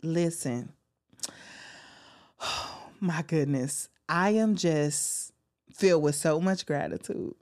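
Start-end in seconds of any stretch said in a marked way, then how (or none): none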